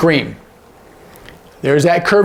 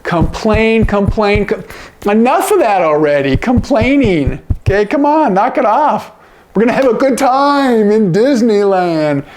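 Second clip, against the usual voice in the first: higher, exasperated parent voice; fed-up parent; 6 semitones higher than usual. Acted. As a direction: scold the kids from the front seat. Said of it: "Mom or dad might say".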